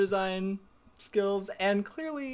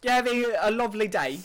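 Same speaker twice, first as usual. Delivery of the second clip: mock Australian accent, a bit higher; hammed-up Australian accent; 6 semitones higher, 3 dB louder, faster than usual. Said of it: "that's Australian".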